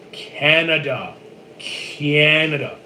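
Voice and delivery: very slow, drawn-out CANADA; slow, exasperated answer; emphatic repetition. Real, not acted. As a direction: stretch out each Canada as if spelling it out for slow listeners. Exasperated at repeatedly asked origin.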